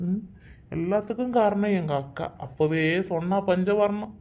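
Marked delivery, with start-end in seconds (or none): none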